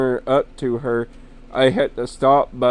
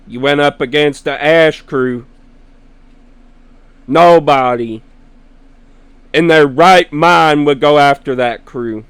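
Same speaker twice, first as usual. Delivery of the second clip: retold loud warning; 3 semitones higher, 9 dB louder than usual. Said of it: "he goes".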